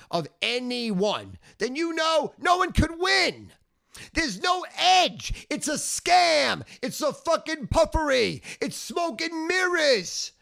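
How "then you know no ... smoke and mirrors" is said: high, slow ranting yell; furious shout. Real, not acted. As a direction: shout it like a tirade. Ranting that gambling is scam.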